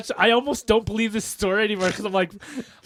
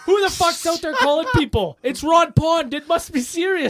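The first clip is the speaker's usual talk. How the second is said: high excited townsman voice; roused townsman; 9 semitones higher, 3 dB louder than usual. Pretend. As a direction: play a startled local, pitched up. Acting townsfolk woken by Ron Pond.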